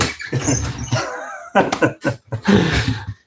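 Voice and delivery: breathy, silly giggle that gets away from him; giddy laugh. Real, not acted. Host cracking up on his livestream, giddy laugh. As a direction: let a giddy giggle slip out. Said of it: "Sorry, I'm getting giddy".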